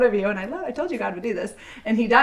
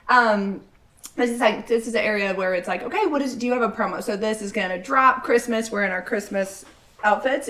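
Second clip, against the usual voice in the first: ordinary talk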